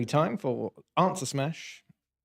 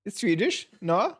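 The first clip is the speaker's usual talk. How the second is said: high-pitched Swedish attempt slipping into German; bad Swedish accent; 6 semitones higher than usual. Quiz host failing Swedish for her friends, high-pitched wandering accent. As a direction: fumble a comic Swedish accent, pitched well up. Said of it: "It's going into a bit of German".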